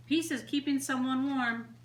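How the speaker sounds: ordinary delivery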